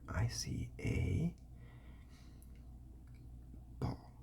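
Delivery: "I see a ball." slow, leaning on "ball"; emphasized. Shown as a demonstration. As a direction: stretch and stress "ball". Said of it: "You can emphasize it more".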